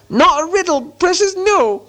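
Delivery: very high creature protest; Gollum voice. A narrator's reading. Gollum disputes the riddle game, whining high.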